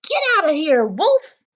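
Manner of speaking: loud, high, rude pig snapping back; rude answer. Acted. Rude pig in the brick house shouting at the wolf. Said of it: "what that rude little porker answered".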